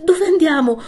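high, loud, bewildered cry; voicing a distraught woman who suddenly recognises someone; astonished exclamation. A narrator's reading. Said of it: "Lo fissò di nuovo ed esclamò".

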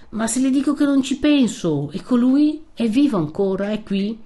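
high, forceful retort; impatient insistence. Acted. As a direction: brush the warning aside and demand an answer. Young man brushes off warning, demands news.

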